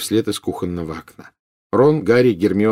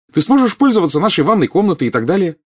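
excited, high and louder; joyful exclamation; 9 semitones higher, 5 dB louder than usual. A narrator's reading. Girl tells new captain about his perks, delighted. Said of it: "радостно воскликнула Гермиона".

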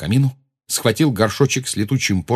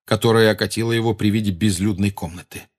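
plain reading voice

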